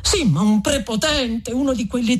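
high, urgent objection; eager protest. A narrator's reading. Renzo defending the friar's killing, high and urgent. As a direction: jump in to excuse him.

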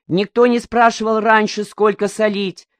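a touch of a stage yell from the chairman; shouting. Acted. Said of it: "закричал Коника".

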